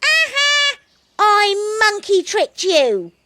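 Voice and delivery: loud triumphant crowing; gleeful call. Acted. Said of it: "called out the monkey".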